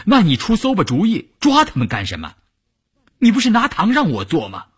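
high-pitched protest; exasperated complaint. A narrator's reading. Troubled man complains to his scheming partner. (为难又着急地高声埋怨)

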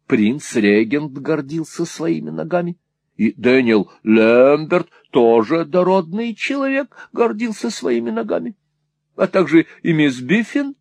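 garrulous Mrs Nickleby warming to her theme; rambling, enthusiastic mother. Acted. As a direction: build eagerly through a silly list.

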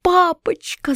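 high, desperate cry of «папочка»; a girl's frightened cry. A narrator's reading. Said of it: "закричала я".

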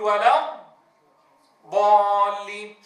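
ضالين stretched with an empty hollow sound; teacher demonstrating the wrong hollow ضالين; hollow vocal gap. Shown as a demonstration. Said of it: "نطق فراغ صوتي".

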